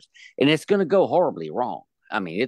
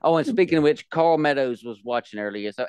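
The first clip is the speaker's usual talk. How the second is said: ordinary talk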